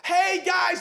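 high, loud call across the water; yelling. Retold or imitated. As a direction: call out to men in a far boat. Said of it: "he yells at them".

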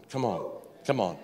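ordinary talk

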